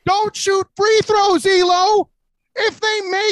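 loud, high heated shout. Real, not acted. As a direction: raise your voice to cut in. Host cuts in shouting at Elo.

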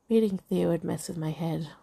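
plain reading voice

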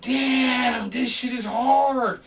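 high, slow, drawn-out exclamation; retold amazement. Hearing the rapper's CD at last, amazed exclamation. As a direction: stretch it out, blown away by the music.

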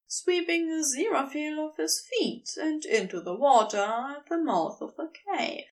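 ordinary delivery, nothing marked

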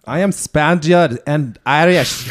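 put-on loud, slow muddle of Spanish and Irish accents; blended accents. Showing friends his blended accents, Spanish-Irish muddle. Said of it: "I just blend 'em together".

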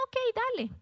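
high, offhand king agreeing; casual king. Retold or imitated. King carelessly approving Haman's plan, high and offhand. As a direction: toss off the king's casual okay.

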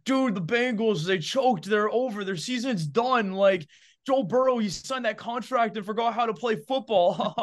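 hammed-up doom-saying football fan; mocking overreacting fans. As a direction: play the panicky fan who writes the team off. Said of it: "I see people already saying".

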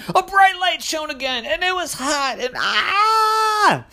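high, loud storytelling rising to a cry; dramatic build. A narrator's reading. Narrator reads the light's return to children, high, loud and dramatic.

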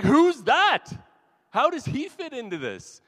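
high, loud incredulous questions; who on earth is this guy; baffled outburst. Acted. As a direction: blurt it out in disbelief. Acts out baffled reaction to odd figure.

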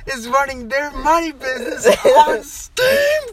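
high, loud screech breaking with laughter; laughing, hammed-up outrage; incredulous shriek. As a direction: build to a stretched scream on the last words. Acted incredulous scream, near laughter.

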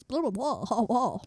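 lightly imitated chattering assistant; mocking Siri. Mocking how Siri talks, blah-blah imitation. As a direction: mimic a blabbering assistant. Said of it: "She talks like this".

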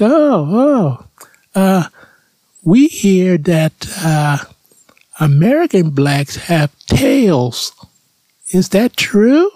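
a shy, high, halting foreign question; German woman voice. Retold or imitated. A German village woman timidly asks Jay a question, high and slow. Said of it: "She's like".

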